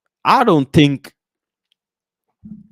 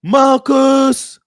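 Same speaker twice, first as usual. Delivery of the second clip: high, loud drawn-out announcer call; stretched stadium goal call; 12 semitones higher, 5 dB louder, much slower than usual. Retold or imitated. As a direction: boom it like a Turkish goal announcer. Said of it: "they'll go like".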